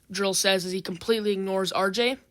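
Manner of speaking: ordinary delivery, nothing marked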